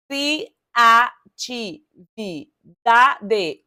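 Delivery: cri-a-ti-vi-da-de, each syllable stretched very slowly; split into syllables. Shown as a demonstration. Teacher testing a word's syllables, very slow. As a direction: tap out Criatividade syllable by syllable. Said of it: "Um, dois, três, quatro".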